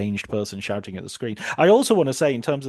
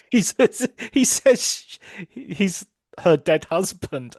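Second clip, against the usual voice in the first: cracking up; 7 semitones higher, 4 dB louder, slower than usual. Real, not acted. Cracks up quoting favourite line.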